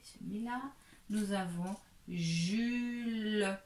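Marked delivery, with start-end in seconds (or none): 2.1-3.6 "Jules" slowly stretched; sounded-out name. Shown as a demonstration. Teacher hooks syllables into a first name, drawn out. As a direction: draw out "Jules" through every sound. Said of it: "si nous accrochons".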